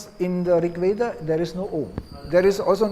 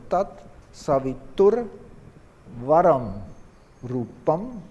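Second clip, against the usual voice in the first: "tat savitur varam rupam" slowly, each word's accent marked; "tat savitur varam rupam" spaced out with deliberate stresses; accented syllables; 3 dB louder, slower than usual. Shown as a demonstration. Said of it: "I have added the accent".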